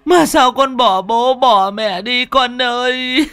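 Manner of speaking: high, loud, slow keening wail; wailing mother. Acted. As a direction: wail over the dead son's body. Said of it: "mà khóc rú lên". (mẹ khóc than thảm thiết, cao, to và chậm)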